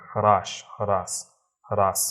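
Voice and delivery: slow হ্রাস with the h sounded, "hraas"; Hindi pronunciation. Shown as a demonstration. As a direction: draw out হ্রাস, h before the r. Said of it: "হিন্দিতে হর উচ্চারণ হবে".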